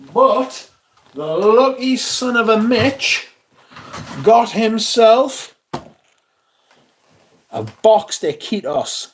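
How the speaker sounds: mock envy. Real, not acted. Teasing about his friend's purchase.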